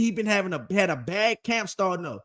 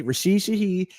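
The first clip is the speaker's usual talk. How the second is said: usual speaking voice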